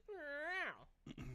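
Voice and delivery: high, drawn-out imitated cat meow; voicing the cat with a high meow. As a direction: mimic the cat's plaintive meow. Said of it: "he was just like".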